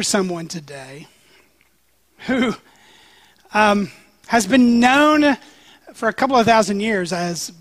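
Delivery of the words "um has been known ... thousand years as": ordinary delivery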